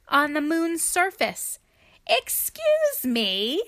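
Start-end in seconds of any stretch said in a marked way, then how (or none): 2.1-3.6 polite apology. Acted. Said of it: "she said".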